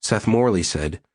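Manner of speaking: ordinary delivery, nothing marked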